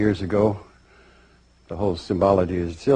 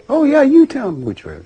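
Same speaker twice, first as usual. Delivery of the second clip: eager high reply; 5 dB louder than usual. Acted. Soul answers the recruiter, eagerly.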